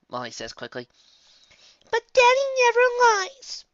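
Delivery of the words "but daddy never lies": put-on high, loud little kid voice; child's protest. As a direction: protest loudly, drawing out the words. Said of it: "I protest".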